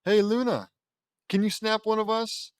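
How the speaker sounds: high, loud call to a friend. Acted. Calls Luna over for a photo.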